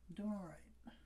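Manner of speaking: whispering. Real, not acted. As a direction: whisper it to yourself. Whispered self-reassurance late at night.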